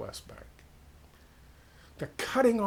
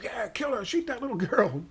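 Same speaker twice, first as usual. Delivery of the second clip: pumped-up mob; 8 semitones higher than usual. Retold or imitated. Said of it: "Everybody's all pumped up".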